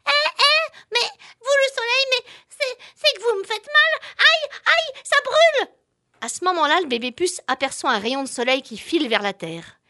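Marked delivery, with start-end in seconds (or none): 0.1-5.7 high, loud yelping flea; a tiny flea getting burned; baby flea in pain. Acted.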